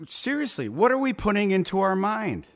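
usual speaking voice

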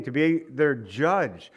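slow, leaning hard on "judge"; stress on judge. Shown as a demonstration. Preacher to his church, slowly stressing "judge". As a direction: draw out and press the word "judge". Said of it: "I stress that word judge".

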